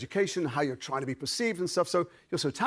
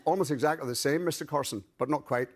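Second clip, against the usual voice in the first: Northern Irish accent demonstration; conversational Belfast accent. Shown as a demonstration. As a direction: talk like someone from Belfast. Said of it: "jump across to Belfast".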